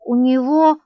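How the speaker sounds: put-on little boy whining; nasal whining child. A narrator's reading. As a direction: whine it like a spoilt kid. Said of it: "отвечал Ваня гнусливо".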